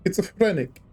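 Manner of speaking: toned-down "Schizophrenic", short R; Linda. Acted. Echoing the impression, a milder high voice. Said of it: "just channeling Linda now".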